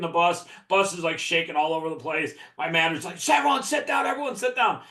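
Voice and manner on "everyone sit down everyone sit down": high-pitched imitation of the manager ordering everyone down; mock manager shout. Manager on a shaking bus telling the team to sit, frantic high call.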